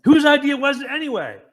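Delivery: high, loud frustrated shout; retold yell. Panicked disciple yelling at the others in the boat. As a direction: shout it out over the storm. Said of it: "someone yells".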